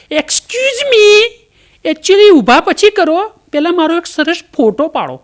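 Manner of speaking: mock-polite lady wanting a photo first; fussy status-crazy lady. Fallen woman demanding a photo.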